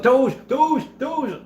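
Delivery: high-pitched crowd chanting in excitement; clamoring mob. Retold or imitated. Streamer mimicking people answering Musk's question, high-pitched mob clamor. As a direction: imitate a mob squawking one word over and over. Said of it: "they were like a mob".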